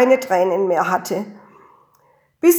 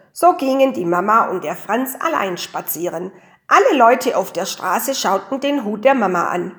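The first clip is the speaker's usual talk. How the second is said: usual speaking voice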